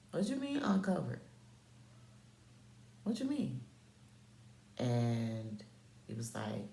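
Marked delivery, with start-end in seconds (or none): 0.1-3.6 high-pitched retold disbelief; incredulous challenge. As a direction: question him in rising-pitched bafflement.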